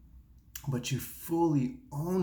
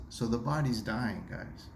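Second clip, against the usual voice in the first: usual speaking voice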